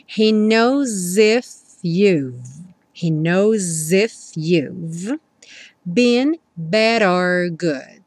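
very slow, stretched English line; slow model line. Shown as a demonstration. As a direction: draw each word out for learners. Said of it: "Rarará no bad". (frase lenta e pausada)

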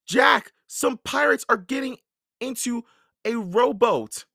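high, loud warning; urgent warning. A narrator's reading. Annie warns Jack about pirates in a rowboat, urgent. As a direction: sound the alarm, pitched well up.

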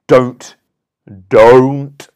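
sharp loud clipped Don't, Don't; abrupt firm command. Shown as a demonstration. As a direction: punch each word like a blow. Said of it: "you hear how that lands?".

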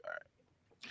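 breathy whisper of giving up; whispered resigned all right. Retold or imitated. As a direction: mutter it under your breath, defeated. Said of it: "I'm like".

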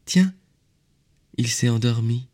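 put-on high child-like wonder; little prince. A narrator's reading. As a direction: notice it in a small boy's high voice. Little prince notices sheep asleep.

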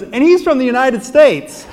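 high, loud mock betrayal; gleeful announcement. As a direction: blurt it out loudly for everyone. Friend gleefully gives him away.

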